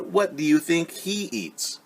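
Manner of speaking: plain reading voice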